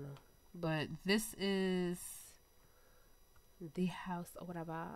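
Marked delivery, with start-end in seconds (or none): 3.7-4.9 soft unvoiced whisper. Real, not acted. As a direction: breathe it out as a whisper. Touring the house quietly.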